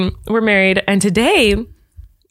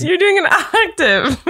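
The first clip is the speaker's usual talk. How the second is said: laughing; 9 semitones higher, slower than usual. Real, not acted. Laughing at failed harmony.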